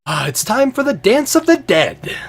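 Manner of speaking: high, loud theatrical proclamation; dramatic Fabler. Acted. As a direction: announce the dance with relish. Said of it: "he says".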